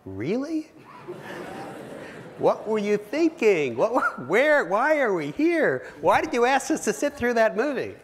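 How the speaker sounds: high, loud, disbelieving outburst; incredulous distributors. Acted. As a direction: play baffled, indignant buyers demanding an explanation. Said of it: "they look at you like".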